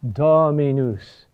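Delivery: Dominus with a drawn-out, stressed DO; stress on third-to-last syllable. Shown as a demonstration. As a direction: lean slowly on DO, then drop through minus. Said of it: "The third to last syllable".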